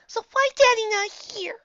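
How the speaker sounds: put-on loud, high little kid voice; insistent child. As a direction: demand an answer in a slow, high child voice. Child asks mother insistently.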